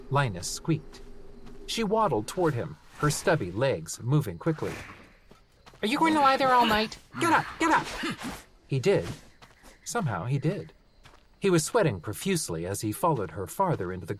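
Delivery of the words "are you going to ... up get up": high, fast, impatient gnome; impatient shout. Acted. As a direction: hurry him up, shrill. Gnome hurrying him.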